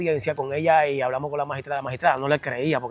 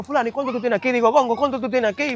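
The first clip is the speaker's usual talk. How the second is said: preguntando a los presos; 9 semitones higher than usual. Retold or imitated.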